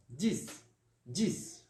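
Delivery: dix as "dis", final X said as S; French dix pronunciation. Shown as a demonstration. Teacher modelling ten for pupils, dix with S sound. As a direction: say dix ending on an S hiss. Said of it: "vai ficar como?".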